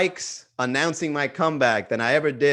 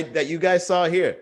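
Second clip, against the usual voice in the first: normal talking voice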